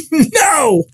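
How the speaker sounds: high-pitched screamed "No"; loud scream. Retold or imitated. Nine-year-old boy refusing the healer's summons, screamed. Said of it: "I screamed real loud".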